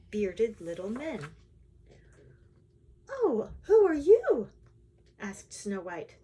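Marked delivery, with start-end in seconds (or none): 3.1-4.5 high, loud startled question; storybook princess taken aback; startled surprise. A narrator's reading. Princess startled by six strangers.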